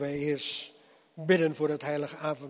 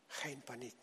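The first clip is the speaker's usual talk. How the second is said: geen paniek repeated much softer, falling gently; soft reassurance; 2 semitones lower, 17 dB quieter than usual. Acted. Preacher soothing his congregation, softer reassuring echo. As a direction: ease it down like a hush to the worried. Preacher calming fearful believers.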